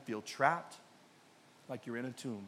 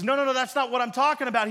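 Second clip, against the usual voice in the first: quick, louder, higher insistent denial; emphatic correction; 6 semitones higher, 6 dB louder, faster than usual. Acted. Jesus correcting Martha about the resurrection, emphatic correction. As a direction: cut in firmly to set them straight.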